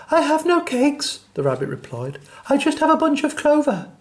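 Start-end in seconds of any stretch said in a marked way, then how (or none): 0.1-1.2 high, timid little rabbit voice; small rabbit. Acted. Rabbit answering the rat in a high little voice. 2.5-3.9 high, timid little rabbit voice; small rabbit. Acted. Rabbit answering the rat in a high little voice.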